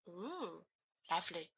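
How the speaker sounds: very soft, low murmur; understated, hushed comment; quiet reaction. Acted. Listener responds to gory ending, very quiet low murmur.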